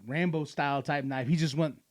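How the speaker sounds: normal talking voice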